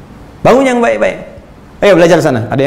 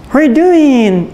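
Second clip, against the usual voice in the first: slow, drawn-out English accent, a bit higher; 11 semitones higher, much slower than usual. Shown as a demonstration. Preacher imitating an English speaker to his audience, slow English accent. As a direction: stretch it out like a native English speaker. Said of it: "kayak orang Inggris".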